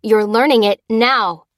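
loud, forceful order; firm command. A narrator's reading. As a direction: leave no room for refusal. Overruling the panicked clerk.

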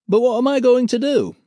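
high, loud, plaintive Pete; Pete's voice. Acted. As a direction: whine it helplessly. Pete asking, worried.

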